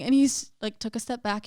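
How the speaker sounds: ordinary talk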